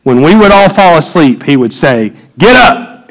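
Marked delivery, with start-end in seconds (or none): 2.4-3.1 mock English accent, pitched higher; higher-pitched British imitation. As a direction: imitate an Englishman's wake-up call. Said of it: "in his English British accent".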